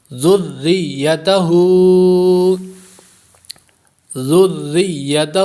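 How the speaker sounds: ذریتہ recited slowly with zal clearly joined to the doubled ra and ya; ذریتہ صحیح تجوید کے ساتھ; correct tajweed. Shown as a demonstration. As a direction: join zal, ra and ya audibly in ذریتہ. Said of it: "واضح طور پر محسوس ہو کہ ملایا جا رہا ہے".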